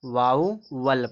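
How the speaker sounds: "वाउवल" slowly as vau-wal; demo pronunciation. Shown as a demonstration. Teacher repeats the reading for students, "वाउवल" slow and split. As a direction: say "वाउवल" with a clear ow glide, then wal. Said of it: "पढ़ा जाएगा".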